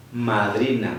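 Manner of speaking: ordinary talk, nothing marked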